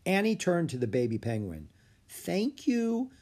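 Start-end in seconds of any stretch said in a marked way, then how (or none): 2.1-3.1 tender. Acted. Annie thanking the baby penguin, high and tender. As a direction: speak sweetly to a baby.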